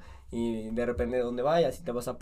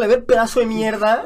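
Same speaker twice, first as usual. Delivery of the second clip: higher, louder mocking jeer; mock insult; 7 semitones higher, 9 dB louder than usual. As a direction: jeer at the kid in play. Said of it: "Que no hablaba español".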